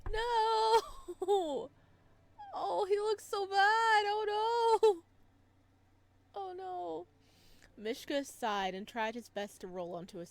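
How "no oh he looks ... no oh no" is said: loud, high cry of dismay, drawn out; high, loud, slow wail of alarm; distressed outcry. Real, not acted. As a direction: cry out as if he is hurt. Reacts to injured Mishka.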